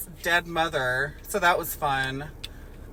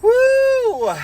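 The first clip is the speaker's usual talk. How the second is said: high, loud whoop; a sudden burst of a whoop; cheer; 8 dB louder than usual. Real, not acted. Co-host breaking the chatter with a loud, high whoop.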